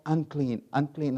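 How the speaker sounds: crying unclean. Acted. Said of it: "and cry".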